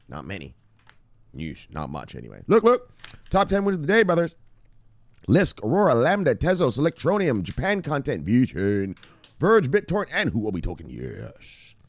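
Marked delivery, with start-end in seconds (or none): 2.5-10.9 hype show host. Acted. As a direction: pump up the brothers like a show opener.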